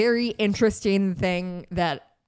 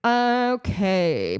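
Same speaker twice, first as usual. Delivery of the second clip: normal talking voice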